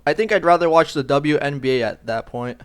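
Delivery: ordinary delivery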